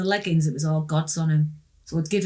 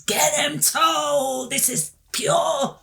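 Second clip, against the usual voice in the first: demon voice; 9 semitones higher, much slower than usual. Retold or imitated. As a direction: bellow it like a possessing demon. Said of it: "turn me into a demon".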